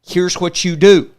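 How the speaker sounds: re-enacted frustrated insistence, rising in volume; frustrated mentor. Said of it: "he got frustrated with me".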